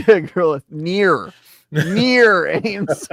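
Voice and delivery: high laughing protest. Real, not acted. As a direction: laugh while correcting. Laughing correction of joke.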